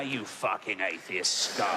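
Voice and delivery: low, slow devil growl; hammed-up low demon snarl; mock devil, low and slow. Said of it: "he's just there".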